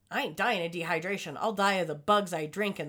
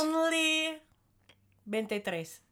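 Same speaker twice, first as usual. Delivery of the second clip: "veintitrés" said the Spanish way, a high playful "Only" before it; Spanish accent; 7 semitones higher, slower than usual. Shown as a demonstration. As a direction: switch into Spanish for the number. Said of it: "I know that I was speaking Spanish".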